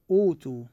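"أوتوا" as oo-too, smooth long first vowel; waw as long vowel. Shown as a demonstration. Teacher giving the waw example, "أوتوا" with long oo. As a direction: hold the oo at the start of "أوتوا". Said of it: "ناخذ مثال على الواو".

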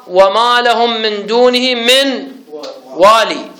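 slow recitation, "والي" ending on long ee; Ibn Kathir's reading. Shown as a demonstration. Teacher recites second example verse, "والي" with long final ee. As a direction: stop on "والي" with the yaa sounded. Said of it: "فقال هكذا في قراءته".

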